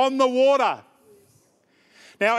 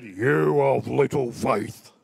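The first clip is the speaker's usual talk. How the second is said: low, slow, heavy reproach; a lower, drawn-out stern Jesus, as commentators read it; stern rebuke; 9 semitones lower, much slower than usual. Retold or imitated. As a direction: say it like a disappointed scolding. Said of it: "I don't read it like that".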